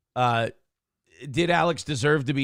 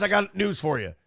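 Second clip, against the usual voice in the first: ordinary talk, nothing marked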